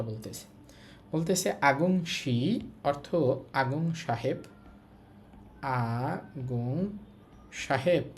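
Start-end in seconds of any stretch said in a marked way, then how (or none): 5.6-8.0 very slow, word by word, for learners; slow teaching read. Shown as a demonstration. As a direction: spell it out slowly for the class. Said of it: "teacher reading syllable by syllable". (ধীরে ধীরে পড়ে শোনানো)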